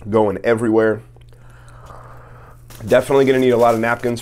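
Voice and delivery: chewing while talking; eating a messy sandwich; mouth full. Real, not acted. Seen 1: Holds sub throughout; bites at 7.8–8.5, talks with food.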